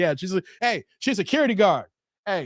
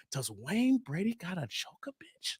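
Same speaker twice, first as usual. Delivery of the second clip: whisper; 12 dB quieter than usual. Retold or imitated. Retelling Wayne Brady skit line.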